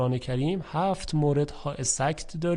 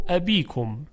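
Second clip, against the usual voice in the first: ordinary talk, nothing marked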